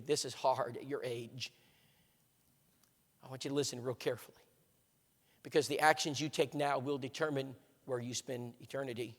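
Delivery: low, soft, grave reassurance; gentle counsel. Retold or imitated. Older man advising upset young woman about eternity, gentle. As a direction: counsel her quietly and earnestly, with pauses.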